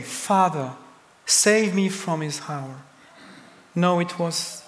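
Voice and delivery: ordinary talk, nothing marked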